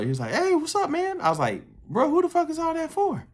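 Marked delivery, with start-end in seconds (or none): 0.3-1.2 high-pitched imitation of a buddy's hello; retold friendly greeting. Acting out the gym friend's greeting. 1.9-3.3 high-pitched mock disbelief; retold incredulous question. Retold question about pile of sandwiches.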